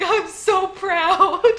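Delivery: mock proud sob, half laughing; a touch of teary grandpa pride, slowed; laugh-crying old man, lightly hammed; crying-laughing Garp. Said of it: "like, crying, laughing".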